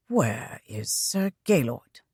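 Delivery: quiet, slow, flat question. A narrator's reading. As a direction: ask it flatly with no feeling. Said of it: "his voice flat".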